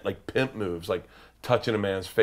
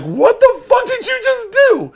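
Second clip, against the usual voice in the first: stunned shout; 8 dB louder, slower than usual. Retold or imitated. Patrice stunned by the shush.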